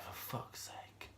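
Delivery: whispered, drawn-out curse under the breath; muttered swear. Retold or imitated. Reviewer quoting their reaction to bad spiders, whispered curse. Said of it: "Sorry, no swearing".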